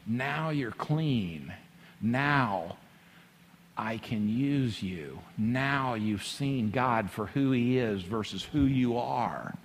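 slow, hushed and low; soft, measured pronouncement with long pauses; Spirit of God. Acted. Spirit of God tells Isaiah he is clean, hushed and slow. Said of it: "And the Spirit of God says".

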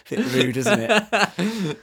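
slow, laughing through the words. Real, not acted. Guest laughing at his own rude-gesture joke, laughing slowly. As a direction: giggle your way through the words. Joking about rude two-finger sign.

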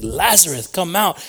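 commanding call. Retold or imitated. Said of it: "he's like".

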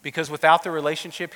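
usual speaking voice

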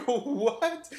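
high, disbelieving what; incredulous what. Retold or imitated. Reacting to the student's double standard, in high disbelief. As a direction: squeak out your shock.

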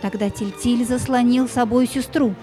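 normal talking voice

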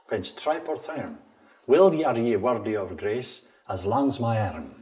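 attempted Scots accent, declaimed as verse. Shown as a demonstration. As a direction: recite the poem in Scots. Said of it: "I'm gonna try in a Scots accent".

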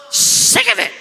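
high-pitched, put-on fed-up outburst; mock exasperation. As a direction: squeeze it out high, mocking the complainer. Mock exasperated echo of the complaint.

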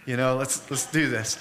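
put-on gently urging a hurting child on; soothing his daughter. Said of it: "I'm like".

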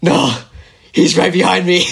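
mock out-of-breath warning; imitating winded. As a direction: act winded from running, broken by a pause. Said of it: "like, all winded".

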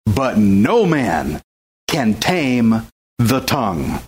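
slow, low, weighty reading of the verse; slow scripture reading. Acted. Preacher reading the verse to the congregation, slow and low. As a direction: let each phrase of scripture land slowly.

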